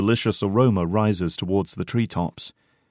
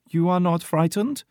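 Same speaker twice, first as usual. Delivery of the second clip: put-on high-pitched girl soldier voice; much higher, questioning girl's voice; 10 semitones higher than usual. A narrator's reading. Girl soldier asks Porter if he's scared, high girlish voice. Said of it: "asks the girl soldier".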